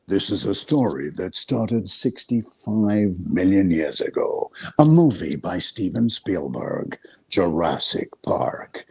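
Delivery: deep, slow trailer voice; low dramatic voiceover read; movie trailer narration. Shown as a demonstration. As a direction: intone it like an epic film trailer. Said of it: "I had done Jurassic Park, the narration".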